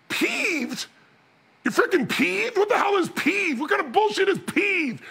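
high-pitched, mock-appalled questioning; hammed-up exasperated disbelief; incredulous outrage. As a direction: throw PEEVED back in disbelief. Acted disbelief at partner's mild word.

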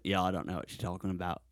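low, soft, lightly Australian reply; Australian local. Acted. Australian man still baffled by the visitor, soft Australian accent. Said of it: "a guy in the lobby in Australia".

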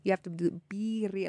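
birria with a long stressed I and a trilled R; emphasized I's. Shown as a demonstration. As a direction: stretch the I in birria. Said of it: "really emphasize the I's".

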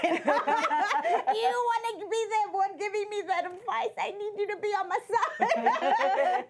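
high-pitched, laughing protest; hammed-up incredulous comeback, half laughing; mock indignation. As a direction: play up the betrayed wife. Retold indignant reply to husband.

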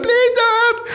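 high, loud fake sob; mock crying plea. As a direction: beg them not to order, wailing.

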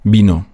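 Vino starting with a soft b. Shown as a demonstration. Teacher demonstrating the Spanish V, Vino with soft B. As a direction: say Vino as "bino". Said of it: "wie ein weiches B".

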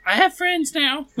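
high, meek halfling voice; Tulio voice. Acted. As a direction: say it small and a little proud.

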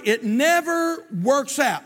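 "never" stretched out, high and forceful; emphatic, drawn-out "never" in a preaching voice; stress on never. Real, not acted. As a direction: lean hard and long on the word "never". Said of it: "I wanna emphasize that word never".

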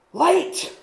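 high, excited cry; sudden discovery. Retold or imitated. Bilbo glimpsing light through the trees, high and excited. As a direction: gasp it out as the light breaks through.

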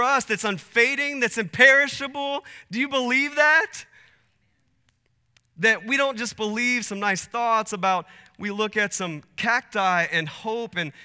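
loud, high, impassioned pulpit voice; full-force preaching. Acted. Preacher telling the church what we believe, full-force preaching. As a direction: drive it out loudly to the whole congregation.

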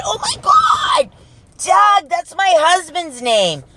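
high, shouted, stretched-out surprise; mock gasp of surprise. Telling the car salesman his name matches her husband's, mock shouted surprise. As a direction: squeal it like an amazing coincidence.

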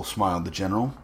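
usual speaking voice

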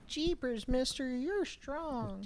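put-on high, slow gee-whiz kid voice; squeaky wide-eyed kid in awe; wholesome 1950s kid. Mock cheer after strong guys.

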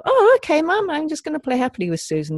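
put-on high, sing-song sarcastic child voice; mock compliant child. Parent mimicking an unrealistically compliant child, high and mocking. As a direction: squeak it out like an overly sweet obedient kid. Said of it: "they're not gonna go".

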